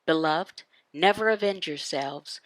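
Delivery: ordinary talk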